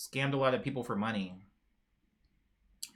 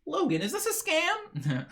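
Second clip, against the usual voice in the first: mock suspicious; 4 dB louder than usual. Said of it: "and now we're like".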